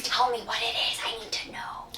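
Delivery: breathy pleading whisper. Real, not acted. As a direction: whisper it urgently to viewers. Pleading sign-off to viewers.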